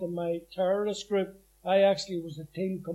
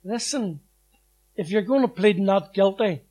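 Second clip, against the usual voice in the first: loud rallying call to the men in the dock; loud rallying call to fellow prisoners; calling out to the guys; 7 dB louder than usual. Retold or imitated. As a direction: rouse the gang, loud and firm. Rallying co-accused before trial.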